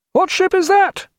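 very high distant challenge; returning hail. A narrator's reading. Strange ship demanding a name, high shouted hail. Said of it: "he heard the returning hail".

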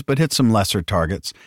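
ordinary delivery

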